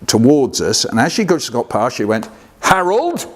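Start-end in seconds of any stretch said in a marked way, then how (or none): 2.6-3.2 high, drawn-up questioning call; imitating the aunt's greeting. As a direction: hail a relative in an affected, high voice. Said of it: "nobody spoke to my dad like that".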